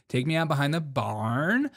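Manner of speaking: put-on Valley girl upspeak on both phrases; mock Valley girl. Host begging to be taken out, mock Valley girl. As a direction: swing the ends up like a Valley girl. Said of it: "She's a Valley girl".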